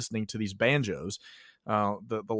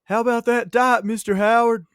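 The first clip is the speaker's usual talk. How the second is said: higher, louder call across the hall; retold yell; 8 semitones higher, 8 dB louder than usual. Kid in the cafeteria teasing his teacher, loud higher yell. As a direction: holler it out to tease the teacher. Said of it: "will yell at me".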